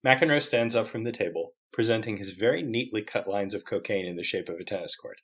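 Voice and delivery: soft, measured reading; quiet, deliberate scene setting; story narration. Acted. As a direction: read the stage directions evenly. Reads script's scene directions.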